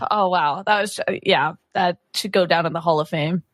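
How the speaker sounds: usual speaking voice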